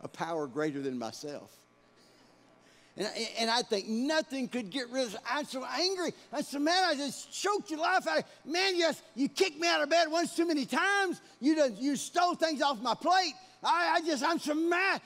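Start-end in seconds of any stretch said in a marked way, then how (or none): 5.3-15.0 loud, high furious rant at his brother; childhood rage. Retold or imitated. Boy raging at the brother who teased him, loud and furious. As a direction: let the old boyhood fury boil over.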